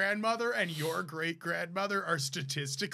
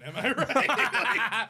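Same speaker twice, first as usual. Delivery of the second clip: laughing; 4 semitones higher, 4 dB louder, much slower than usual. Pretend. Joking aside, drawn out.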